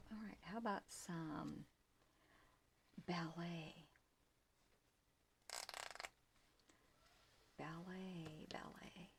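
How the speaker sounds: fading whisper to herself; whispered mutter. Real, not acted. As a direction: mutter the word while searching. Searching, repeating word to herself.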